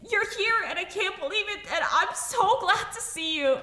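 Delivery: high, rushed, tearfully joyful outpouring; breathless rush of worry and joy, pitched high; very emotional relief. Acted. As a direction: let the words tumble out, overcome with relief. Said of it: "very emotional voice just go".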